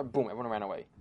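plain reading voice

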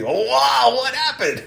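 put-on high, wide-eyed little boy asking; little grandson's voice. Grandfather voicing his grandson hearing the story, amazed. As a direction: play the five-year-old, amazed. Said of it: "He's looking at me".

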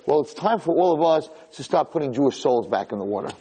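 usual speaking voice

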